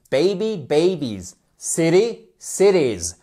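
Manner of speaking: put-on first syllable stressed in BABY and CITY; tonic accent. Shown as a demonstration. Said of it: "vous avez un accent tonique".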